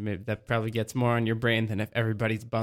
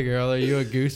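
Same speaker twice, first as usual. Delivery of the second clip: normal talking voice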